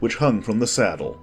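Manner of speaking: usual speaking voice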